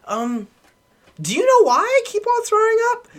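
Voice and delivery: put-on very high, puzzled drunk whine; mock a clueless drunk asking for answers; Ricky. Drunk buddy questions friend trying to sleep, hammed-up high whine. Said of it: "he comes over to me on the couch and he goes".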